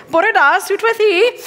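a burst of Welsh, lilting high; Welsh greeting. Shown as a demonstration. Proudly greeting a Welsh audience in Welsh. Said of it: "that's the limit of anything that I have".

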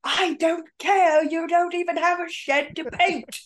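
hammed-up scoff at someone's paint idea; mock dismissive voice. Mock reply to barn paint remark.

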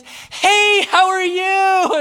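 high, bright-sounding doorstep hello, drawn out slowly; chirpy salesgirl greeting. Retold or imitated. Kirby salesgirl greeting Jess at the door, high and gushing. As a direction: gush a fake-friendly hello like a pushy saleswoman.

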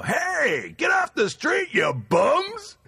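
high, full shout at the street; re-enacted heckling yell; yelling out window. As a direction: holler down at drunks below. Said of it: "I yelled out my window".

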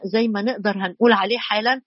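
ordinary talk

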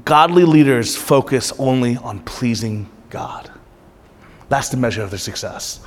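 soft breathy whisper, slow. Acted. Pastor drops to a whisper for his congregation. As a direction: let it sink in with a whisper.

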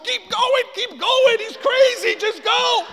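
very high, loud yell; imitating panicked wife. Wife warns other driver off, panicked high shout. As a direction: scream a warning in a high wife voice.

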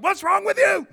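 high, loud exasperated snap; snapping parent. Retold or imitated. Parent snapping at a two-year-old, high and loud. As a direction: bark it down at a toddler. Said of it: "saying to a two-year-old".

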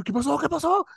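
put-on anxious kids asking. Kids asking what happened.

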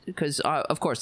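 normal talking voice

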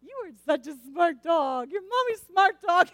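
slow, rising sing-song praise for the dog; mother praising her dog; baby talk to dog. Retold or imitated. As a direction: gush over the dog like a baby. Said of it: "being ridiculous".

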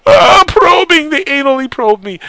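high, loud hammed-up cry of a probed abductee; over-the-top mock wail, high and loud; mock anguished victim. Mock alien victim crying out.

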